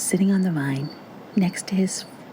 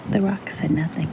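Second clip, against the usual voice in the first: ordinary delivery, nothing marked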